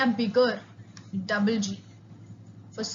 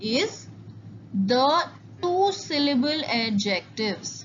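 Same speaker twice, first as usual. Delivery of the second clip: slow dictation; much slower than usual. Shown as a demonstration. Teacher announces new section.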